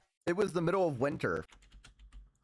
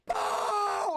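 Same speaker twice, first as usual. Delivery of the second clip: much higher mock yell of the name; mom yelling name. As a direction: call it out like a frantic mom. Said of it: "as loud as she can yell".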